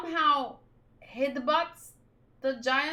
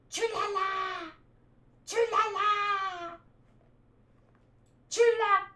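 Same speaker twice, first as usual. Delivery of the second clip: high, drawn-out shouted call across a distance; straining shout toward the departing boats; calling out loud; 8 semitones higher, slower than usual. Acted. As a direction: yell the name after someone walking away. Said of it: "It hurts my throat".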